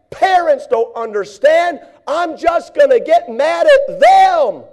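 high, loud, self-pitying rant; hammed-up depressed grumbler; whining complaint. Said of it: "Wrong answer".

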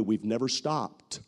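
ordinary talk

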